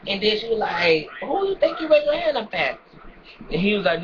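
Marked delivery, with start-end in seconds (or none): 1.2-2.8 mother's angry challenge. Retold or imitated. Mother confronts son retold.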